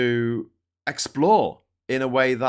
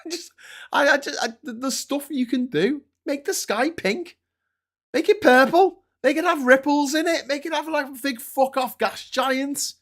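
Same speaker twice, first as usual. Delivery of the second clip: much higher, gushing with excitement; giddy, raised pitch; excited; 12 semitones higher, 4 dB louder than usual. Real, not acted. Excited gushing about alien skies.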